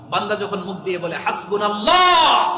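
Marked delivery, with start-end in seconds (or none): 1.2-2.5 loud, drawn-out high shout; soaring devotional shout; impassioned cry. Real, not acted. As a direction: cry out, stretching the last syllable. Cries out the phrase to crowd.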